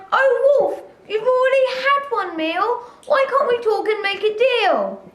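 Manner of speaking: a cornered pig pleading for its life; pleading cry. A narrator's reading. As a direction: beg the wolf for mercy. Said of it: "He cried".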